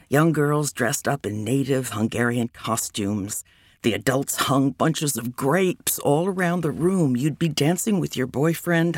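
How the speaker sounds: ordinary delivery, nothing marked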